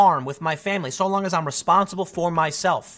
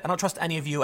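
ordinary talk, nothing marked

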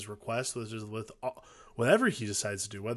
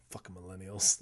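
low, quiet, dry grumble with a laugh; lightly voiced weary cop, deadpan; 5 semitones lower, 5 dB quieter than usual. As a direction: mutter it dryly, lower and softer. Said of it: "he's just like".

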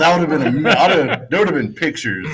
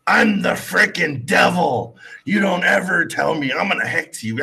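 mock devil-lady yell; 7 semitones higher than usual. Storyteller imitating a woman who claims she's the devil, mock yell. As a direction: play the unhinged woman shouting her threat. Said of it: "She turns around all of a sudden".

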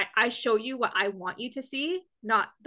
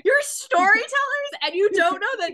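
laughing mock rant, high and loud; mock yelling listener; 11 semitones higher, 6 dB louder, slower than usual. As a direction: yell at the podcast while laughing. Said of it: "Someone's yelling at their podcast".

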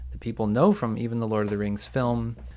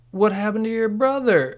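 high, loud baffled question; incredulous outburst; 11 semitones higher, 7 dB louder than usual. Retold or imitated. Podcaster reacting to a missing brother in the show, incredulous outburst. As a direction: burst out high in comic disbelief.

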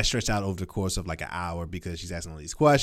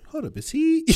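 much higher startled mother; mock alarmed mother, much higher; mock mom. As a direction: do the wife's double take. Mom reading son's texts.

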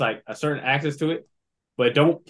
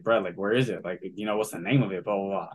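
quiet; 8 semitones lower, 6 dB quieter, faster than usual. Retold or imitated. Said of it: "I was like".

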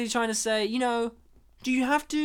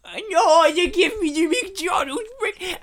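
high, loud mock whine; hammed-up movie-star tantrum; whiny comic actor; 8 semitones higher, 5 dB louder than usual. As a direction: wail it loudly in a silly high voice. Mimics actor's whiny complaint.

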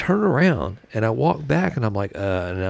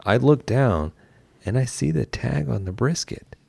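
ordinary talk

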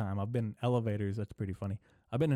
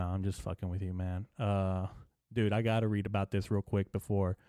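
usual speaking voice

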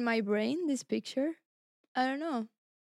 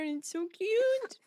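cooing; 8 semitones higher than usual. Real, not acted. Showing a cute chopstick trick.

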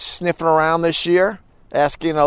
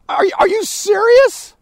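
disbelief; 4 dB louder than usual. Real, not acted. Incredulous reaction to a story.

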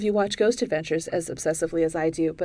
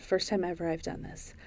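usual speaking voice

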